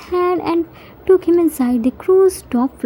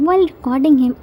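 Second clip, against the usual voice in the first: plain reading voice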